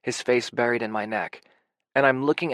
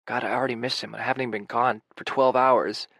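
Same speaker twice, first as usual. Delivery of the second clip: usual speaking voice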